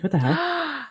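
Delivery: high-pitched retold shock; startled disbelief. Flight attendant finding older brother aboard, retold high-pitched surprise. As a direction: act out being taken aback, pitch jumping up.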